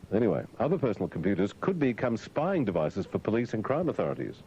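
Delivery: ordinary talk, nothing marked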